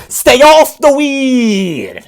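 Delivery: high, loud, drawn-out shout; Stephen A Smith. Retold or imitated. Comic quoting a sports pundit, loud slow high shout. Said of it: "Stephen A Smith".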